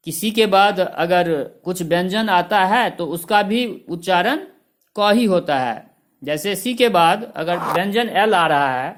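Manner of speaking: normal talking voice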